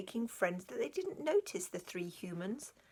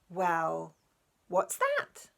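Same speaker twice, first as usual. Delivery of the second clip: a small creature utterly lost at a new word; baffled squeak; 2 semitones lower, 4 dB louder, much slower than usual. A narrator's reading. Animal baffled by weevil.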